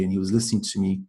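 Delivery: usual speaking voice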